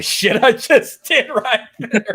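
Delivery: cracking up at his own bit; real laughter breaking in; laughing. Real, not acted.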